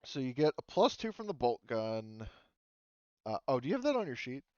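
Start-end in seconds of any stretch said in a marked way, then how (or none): none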